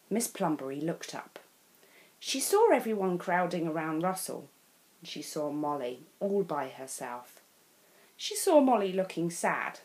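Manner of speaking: ordinary delivery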